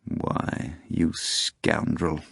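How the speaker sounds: soft, slow, half-breathed musing; a quiet, slowed, unvoiced mutter; pensive mutter. A narrator's reading. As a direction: mutter it to yourself, thinking. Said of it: "Volant muttered pensively".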